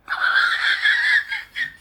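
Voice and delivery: bursts into a loud high laugh; cracking up. Real, not acted. Laughing hard at his own Nixon joke.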